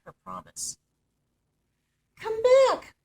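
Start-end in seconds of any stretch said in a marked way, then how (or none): 2.2-2.9 very high, loud call after her. A narrator's reading. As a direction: shout after the departing princess. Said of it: "called the frog".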